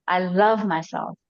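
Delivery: "love" drawn out and leaned on, "myself" falling away; slow affirmation with the weight on "love"; stress on "love". Shown as a demonstration. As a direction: hit the word "love" slowly and firmly. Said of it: "put emphasis on different words".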